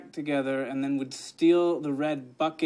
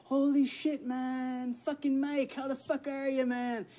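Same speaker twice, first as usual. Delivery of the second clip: much higher, mock Irish-sounding impression of his buddy; mocking his friend's accent; 12 semitones higher, slower than usual. Mocking his friend's greeting in a put-on Irish-ish accent. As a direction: ham up a fake Irish lilt to tease him. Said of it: "What accent is that?".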